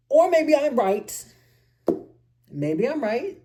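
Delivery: sudden cocky high "or maybe"; tease, loud and pleased; cheeky. Real, not acted.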